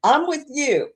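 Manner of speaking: ordinary talk, nothing marked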